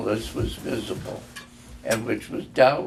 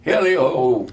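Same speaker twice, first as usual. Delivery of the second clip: louder jovial call; jolly cry; 3 semitones higher, 6 dB louder than usual. A narrator's reading. Fezziwig cries out merrily to his apprentices. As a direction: sing it out merrily. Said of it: "cried old Fezziwig".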